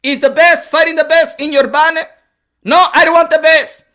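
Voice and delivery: firm demand in an imitated accent; Manny Pacquiao insisting. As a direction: insist like a proud foreign-accented fighter. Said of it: "Manny Pacquiao was, went to the office".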